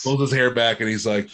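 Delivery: ordinary talk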